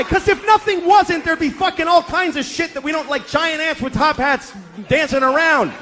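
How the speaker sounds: spiral into comic madness; frantic rising yell. Retold or imitated. Father raving at daughter.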